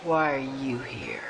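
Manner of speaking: low, slow, hushed whisper; a slow suspicious whisper. Acted. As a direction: breathe the question, barely voiced. Bitter visitor confronts newcomer.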